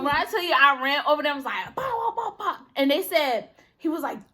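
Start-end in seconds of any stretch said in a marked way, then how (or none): 1.8-2.6 laughing pow effects, much higher; imitated punch sounds. Sister acting out hitting the bully, laughing punch sounds. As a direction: fire off mock punches with a laugh.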